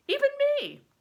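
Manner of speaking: playful high sneer of a grin; a light grinning tease from Bear. Acted. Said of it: "he grinned".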